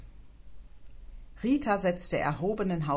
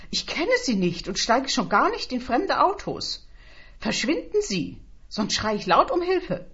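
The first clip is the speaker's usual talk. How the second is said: loud, high-pitched scolding; agitated warning with raised voice; indignant refusal; 7 semitones higher, 5 dB louder than usual. Acted. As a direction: send the stranger packing. Threatening a stranger, acted quarrel.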